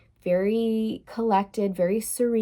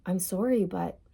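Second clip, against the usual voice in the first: quiet, resigned mock quote; softly final, drained of fight; detached, done; 6 dB quieter than usual. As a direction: imitate someone saddened but completely done. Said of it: "Kind of detached".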